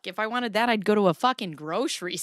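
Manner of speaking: usual speaking voice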